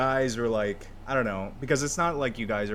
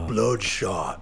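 trailer voice; 3 semitones lower than usual. Pretend. Movie trailer voice.

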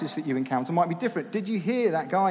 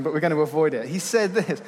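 usual speaking voice

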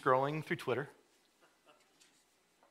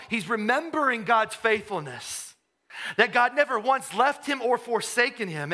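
louder, higher preacher's cry; impassioned preaching; 7 semitones higher, 5 dB louder than usual. Acted. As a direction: preach it out with full fervor. Preaching full force.

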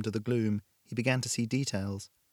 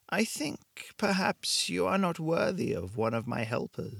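put-on slow, higher, coolly dismissive woman with a vague European accent; cold, slow European accent; 6 semitones higher, slower than usual. A narrator's reading. Shopkeeper judges Blake unworthy, slow and cool, vague European accent. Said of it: "Her accent was impossible to place".

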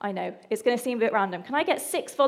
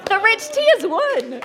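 high, loud shouts through laughter; excited laughing shouts; 5 semitones higher, 6 dB louder than usual. Real, not acted. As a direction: call out the fallen biscuits, laughing. Biscuits collapse in dunking contest.

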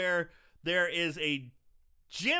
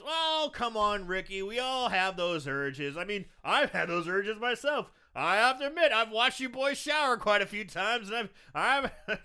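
raised-pitch, hammed-up reassuring coach; creepy coach; 4 semitones higher than usual. Recapper as coach confessing urges to Ricky, hammed-up reassurance. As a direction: play an over-understanding gym coach. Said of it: "and the coach goes".

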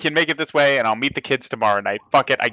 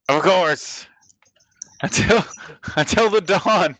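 grand bard voice; 4 semitones higher, slower than usual. Acted. Bard replies in character.

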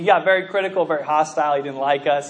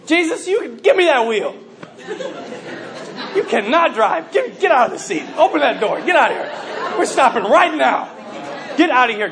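mock-angry ordering about, raised voice; bossy outburst; 5 semitones higher than usual. Driver ordering Jesus out of the seat, mock outrage. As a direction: play an indignant backseat driver taking over.

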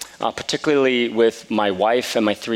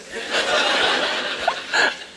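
a sheepish little laugh. Real, not acted. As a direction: laugh at your own expense under your breath. Laughs at himself.

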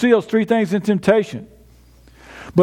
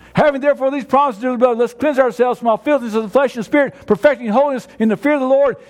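brisk recitation; 3 semitones higher, faster than usual. Shown as a demonstration. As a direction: rattle it off from memory with conviction. Same verse recited again, brisker.

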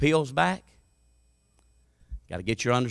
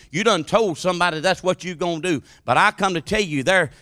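ordinary delivery

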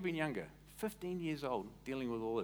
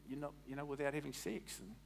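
normal talking voice

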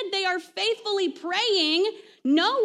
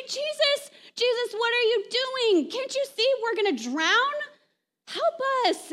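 higher, urgent cry to Jesus in the storm; mock panicked disciples; 6 semitones higher than usual. Disciples in the sinking boat begging Jesus, panicked and high. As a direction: plead for help as if about to drown. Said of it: "the disciples go to him and they say".

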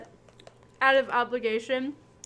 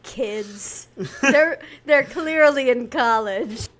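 shrugging mom; 3 semitones higher, 10 dB louder, much slower than usual. Retold or imitated. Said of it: "My mom walked in and just sort of shrugged like".

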